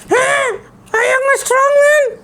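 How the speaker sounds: put-on high shocked shout of a child puppet; can't believe it, squeal it; astonished puppet boy.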